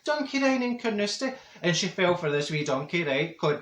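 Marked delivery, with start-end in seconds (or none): 0.0-1.3 Senga's surprise. Retold or imitated. Senga is surprised Marjorie never knew, a high squeal. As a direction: squeal of surprise at her friend. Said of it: "She says".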